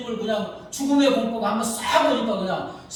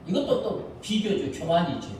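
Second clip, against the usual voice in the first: usual speaking voice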